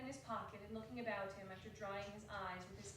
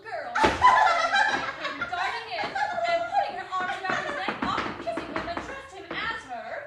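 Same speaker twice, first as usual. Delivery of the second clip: excited narration; 17 dB louder than usual. A narrator's reading. Narrator describing little Fan running in, laughing excitedly. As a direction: narrate with bubbling delight.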